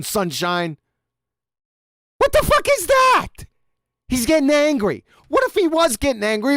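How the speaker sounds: high, heated rant, pitch climbing; agitated rant. Real, not acted. Insisting to the chat he isn't angry, heated high rant. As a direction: rattle on, worked up while denying it. Ranting that he's not angry.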